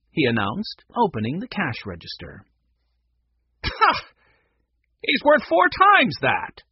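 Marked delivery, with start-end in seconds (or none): 3.6-6.6 high, loud scornful outburst; scoff, then snarl it out slowly; acted indignant snarl. A narrator's reading. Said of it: "Mac snarled".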